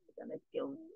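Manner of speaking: breathless whisper of doom; real scared whisper; terrified whisper. Real, not acted. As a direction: whisper it as if it could hear you. Hiding from Enderman in fear.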